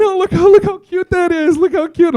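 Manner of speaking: high, loud mocking coo; gushing dog owners. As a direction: gush over the dogs, hammed-up and squealing. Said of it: "all the other dog owners are all standing around going".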